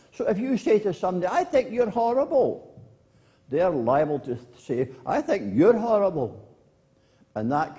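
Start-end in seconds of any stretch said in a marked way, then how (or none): 1.3-2.6 high, mock-cross jab; acted insult. Acted insult in example quarrel. 5.1-6.4 higher, louder retort; acted retort. Acted retort in example quarrel.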